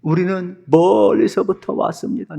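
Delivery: mock-weepy, high-pitched begging; tearful pleading. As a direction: plead as if close to tears. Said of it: "그나마 울먹이면서".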